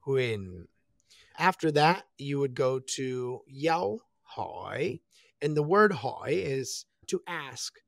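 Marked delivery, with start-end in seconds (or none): 0.1-0.7 low HUYEN, sliding down; a low, falling huyen. Shown as a demonstration. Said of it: "It goes down". 3.5-5.0 slow yao hỏi, HỎI lilting like a question; hỏi dipping, then rising questioningly. Shown as a demonstration. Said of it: "sounds like you're asking a question".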